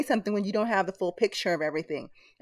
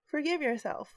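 higher and softer, coaxing; higher, gentler repeat; 7 semitones higher, 5 dB quieter than usual. Shown as a demonstration. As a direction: urge it a second time, softly. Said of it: "Say it again".

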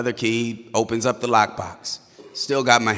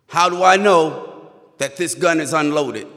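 loud, higher-pitched demand; challenging officer; 6 semitones higher, 5 dB louder than usual. Retold or imitated. Officer doubting the driver about the gun, challenging. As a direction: voice a suspicious cop pressing hard.

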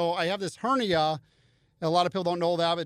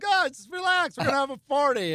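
much higher, playful don't-worry voice; breezy mock reassurance; 10 semitones higher than usual. Fighter brushing off his coach at the party, mock carefree. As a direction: toss it off like it's no big deal. I'm like.

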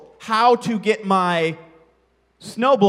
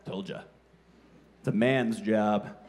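smug gloat; 10 semitones lower, 7 dB quieter than usual. Retold or imitated.

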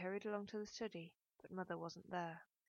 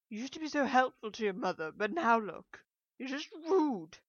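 loud, higher reproach, dragging at the end; wounded scolding; 5 semitones higher, 11 dB louder than usual. A narrator's reading. Mother reproaches daughter through locked door, wounded scolding. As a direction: complain loudly, hurt and worked up.

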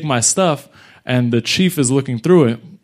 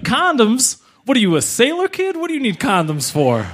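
incredulous cop; 12 semitones higher than usual. Retold or imitated. Cop squeals at a kid caught stealing condoms. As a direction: squeal it like a cop who can't believe it. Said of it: "He's like".

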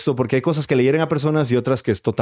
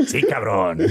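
mock Mexican; 5 semitones lower, slower than usual. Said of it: "retold reply".

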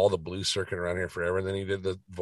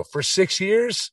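incredulous; 12 semitones higher, 5 dB louder, much slower than usual. Retold or imitated. Retold incredulous question.

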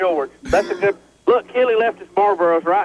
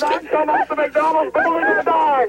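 shouting. Real, not acted. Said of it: "Scream that we're on top".

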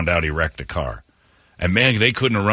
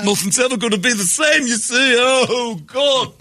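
mock distraught wail. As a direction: wail it like a heartbroken widower. Mock grief over wrecked car.

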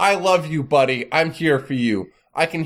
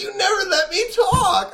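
mocking whine. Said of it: "And then you're like".

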